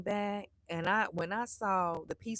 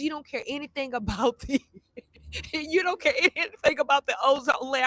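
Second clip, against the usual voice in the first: laughing son; 4 semitones higher, 4 dB louder than usual. Retold or imitated. Son laughing while accusing mom.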